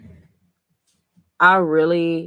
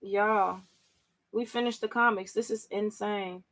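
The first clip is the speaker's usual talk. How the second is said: stunned quiet; 12 dB quieter than usual. Real, not acted.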